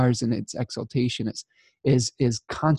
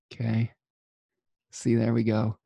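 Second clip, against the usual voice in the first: ordinary delivery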